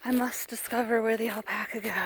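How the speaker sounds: hushed explorer whisper; secretive nature-show whisper. Pretend.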